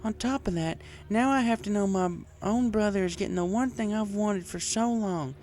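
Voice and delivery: Applejack voiced as drunk farm mare. A narrator's reading. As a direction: play the tipsy farm girl venting.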